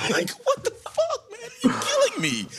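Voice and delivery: high laughing outburst, words breaking into laughter; laughing disbelief. Real, not acted. Host laughing at the president's absurd words, high laughing disbelief. Laughing at Trump's wild quote.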